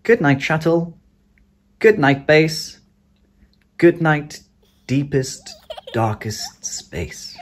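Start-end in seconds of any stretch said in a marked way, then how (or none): none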